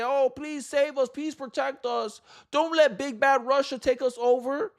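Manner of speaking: imitating Europeans begging for rescue; mock groveling plea. Mocking Europeans begging America to save them, whiny plea. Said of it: "they wanna sit here and cry and grovel".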